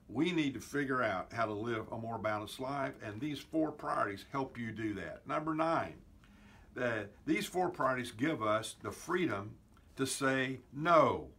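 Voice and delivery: ordinary talk, nothing marked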